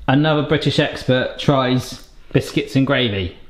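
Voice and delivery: normal talking voice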